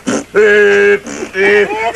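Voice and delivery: loud beeping horn sounds; horn beeps. Retold or imitated. Storyteller honks as the bull farts, loud beeps. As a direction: honk each beep like a car horn.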